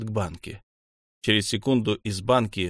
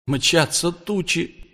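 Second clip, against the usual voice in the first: high, drawn-out verse; like a tense incantation; slow recitation; 7 semitones higher, much slower than usual. A narrator's reading. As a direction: stretch each word, pitched high. Verse returns amid rising panic.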